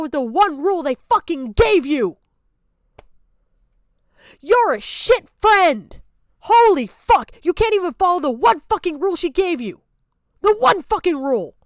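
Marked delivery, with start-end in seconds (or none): 4.4-9.2 furious outburst. Real, not acted. Streamer scolding a former friend, angry and loud. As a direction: let the fury spill out at the friend.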